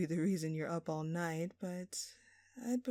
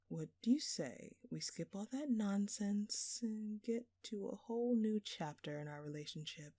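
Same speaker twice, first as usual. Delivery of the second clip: normal talking voice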